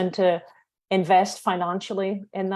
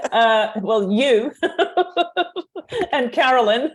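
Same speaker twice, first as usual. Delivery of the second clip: laughing through the words, louder; names tumble out between giggles; real laughing; 6 semitones higher, 5 dB louder than usual. Real, not acted. Asked who she is crushing on, laughing while answering. Flustered naming her crushes.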